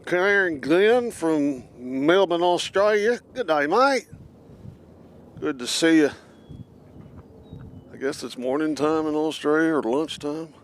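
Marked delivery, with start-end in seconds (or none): 0.1-6.1 lively, loud put-on Australian accent; playful Australian twang. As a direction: greet them in a mock Aussie accent. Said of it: "I gotta get my mouth right".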